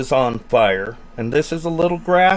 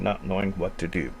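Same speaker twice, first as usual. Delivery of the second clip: ordinary talk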